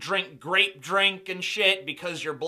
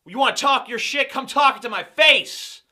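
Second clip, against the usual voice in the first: mock challenge, raised and punchy; confrontational taunt; 5 semitones higher, 6 dB louder than usual. Hypothetical taunt thrown back at Tommy, mock confrontational. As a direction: call him out loudly, putting on aggression.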